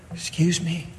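a small, soft nudge for attention; still small voice. Acted. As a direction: gently cut in, barely audible. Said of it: "It's a still small voice".

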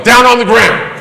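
high loud barked command; police shout. Retold or imitated. Officer yelling at the startled pastor, loud command. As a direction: bark it like a cop at gunpoint. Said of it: "I hear them yell".

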